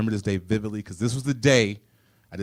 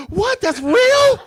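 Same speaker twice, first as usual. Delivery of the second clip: very high, loud scandalized shriek; high-pitched pearl-clutching gasp; shocked disbelief; 10 dB louder, much slower than usual. Retold or imitated.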